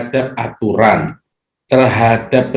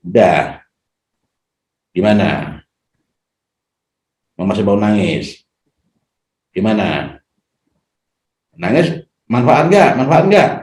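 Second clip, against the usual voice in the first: mocking taunt. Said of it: "tidak mengatakan".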